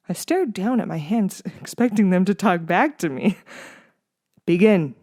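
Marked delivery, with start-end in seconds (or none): 4.4-4.9 firm, stern order; stern command. A narrator's reading. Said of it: "instructed sternly".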